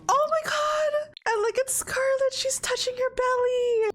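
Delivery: high-pitched, overwhelmed cooing; high gushing squeal. Real, not acted. Player spotting Scarlet touching her belly, squealing. Streamer gushes over pregnant character.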